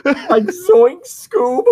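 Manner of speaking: very high cartoon yelp; Shaggy. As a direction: squeak it like a spooked cartoon slacker. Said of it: "doing the Shaggy thing".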